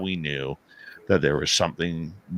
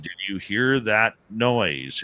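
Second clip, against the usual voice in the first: slow, spaced-out question, pitched up; insistent repeat; 4 semitones higher, 4 dB louder, slower than usual. Retold or imitated. Dave repeats his question to Merle, slow and insistent. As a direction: spell it out word by word, falling on NOISE.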